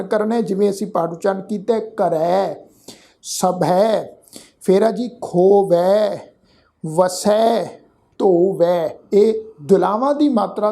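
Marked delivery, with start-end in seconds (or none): 1.8-4.2 ਕਰੈ, ਸਭੈ with long open "ai" endings; pronunciation demo. Shown as a demonstration. Teacher drills the vowel sign, long open "ai" ending ਕਰੈ, ਸਭੈ. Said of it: "ਜੋ ਦੁਲਾਵਾਂ ਦੀ ਮਾਤਰਾ ਹੈ". 5.2-9.0 ਖੋਵੈ, ਵਸੈ, ਧੋਵੈ with drawn-out open "ai" endings; pronunciation demo. Shown as a demonstration. Teacher drills the vowel sign, long open "ai" ending ਖੋਵੈ, ਵਸੈ, ਧੋਵੈ. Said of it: "ਦੀ tune ਵਿੱਚ ਹੀ ਉਚਾਰਨ ਕਰਨਾ".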